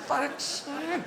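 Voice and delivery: high, drawn-out dismay; exasperated greyhound. Acted. Waiting dog sees the metal wheel, exasperated. Dog discovers the rabbit is fake.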